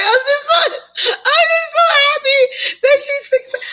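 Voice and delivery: happy sobs spilling over the words; crying with joy. Real, not acted. Telling Stephanie how proud she is, crying and laughing. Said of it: "every time I hear your story, like, I cry".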